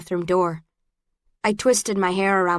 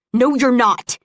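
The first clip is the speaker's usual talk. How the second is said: raised, louder angry retort; fuming; 5 semitones higher, 5 dB louder, slower than usual. A narrator's reading. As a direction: contradict heatedly, pushing the volume. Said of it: "he fumed".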